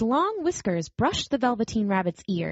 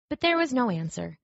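ordinary delivery, nothing marked

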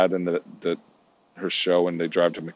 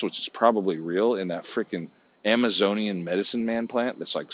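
ordinary talk

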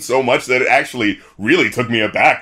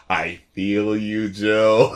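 heartfelt; 4 semitones lower, 5 dB quieter, much slower than usual. Real, not acted. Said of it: "the way she just laid it out".

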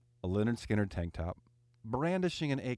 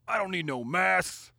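put-on Southern accent; 7 semitones higher, 4 dB louder than usual. Imagined man refusing masks, in a loud mock Southern accent. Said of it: "in a Southern accent, he says".

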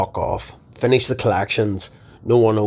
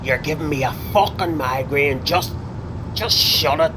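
angry shout; 6 semitones higher than usual. Acted. Boss yelling at him.

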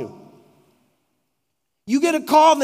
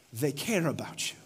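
sincere hush; 8 semitones lower, 11 dB quieter than usual. Real, not acted. Solemn punchline after story.

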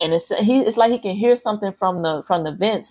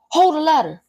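urgent command; 9 semitones higher than usual. Acted. Father racing to the roof telling kids to hold the ladder, urgent.

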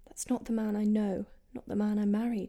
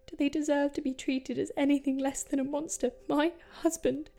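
raised, higher voice of horrified disbelief; anguished outburst; 4 semitones higher, 5 dB louder than usual. Acted. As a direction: reproach him and yourself, pitch climbing. Wife agonises over her monstrous husband.

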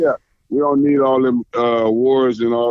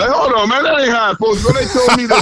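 mock confused robber; 9 semitones higher than usual. Retelling the robber's confused reaction.

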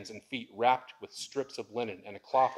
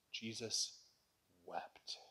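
hushed reverence; 3 semitones lower, 11 dB quieter, much slower than usual. Acted. Dramatic pause, hushed delivery.

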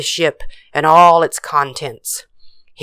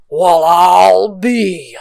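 amazed drawl; 3 dB louder, much slower than usual. Acted. Captain amazed at cookies.

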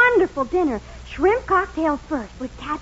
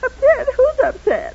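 higher, tearful protest; close to tears; 9 semitones higher, 4 dB louder than usual. Acted. Wife denying she's upset over dinner, close to tears. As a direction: sound about to burst into tears. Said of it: "You sound like you're going to burst out into tears".

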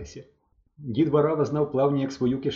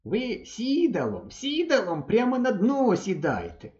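higher, slow fatherly advice; advising old man; 9 semitones higher, slower than usual. A narrator's reading. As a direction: coax him to sit on the bottom, slowly. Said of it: "радив дід". (дід радить мисливцеві, повільно й вище)

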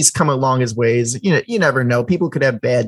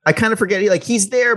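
ordinary delivery

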